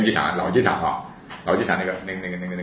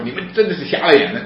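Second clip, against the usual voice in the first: raised, indignant retold rebuke; angry, agitated; 6 semitones higher, 5 dB louder than usual. Upset sister rebukes police, angry and agitated. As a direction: rebuke them loudly, acting the outrage. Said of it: "很生气，".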